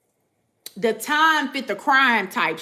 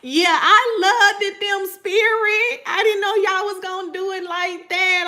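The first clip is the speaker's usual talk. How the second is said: mock innocent whine; 8 semitones higher, 4 dB louder than usual. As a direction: play dumb in a high, dragging voice. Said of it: "you be like".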